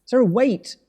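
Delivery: urgent call down the hallway. Acted. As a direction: call her back before she goes. Calling after Sarah leaving.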